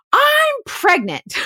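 very high, slow, drawn-out wail; hammed-up shriek; mock screamed announcement. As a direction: imitate a hysterical woman dropping her bombshell. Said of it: "Well, then she says".

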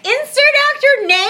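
high incredulous echo; disbelief. Real, not acted. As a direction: repeat it in total disbelief. Incredulous repeat of script line.

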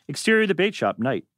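normal talking voice

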